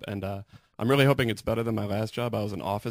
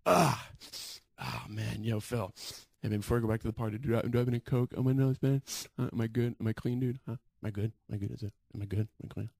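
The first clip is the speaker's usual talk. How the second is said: coked-up friend; 4 dB quieter than usual. Retold or imitated. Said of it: "this one friend of mine was just like".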